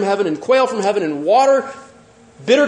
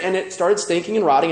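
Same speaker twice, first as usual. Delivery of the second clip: ordinary delivery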